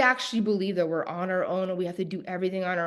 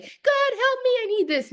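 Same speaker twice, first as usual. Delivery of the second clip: high, loud desperate plea; mock begging; 5 dB louder than usual. Mimicking people who only call on God to beg, high and loud. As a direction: cry out to God like someone in need. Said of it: "now I'm begging God".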